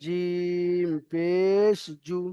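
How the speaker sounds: no lengthening on the last জু; short final vowel. Shown as a demonstration. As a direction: slowly stretch জিম পেশ, end on a brief জু. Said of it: "কোনো টান নেই".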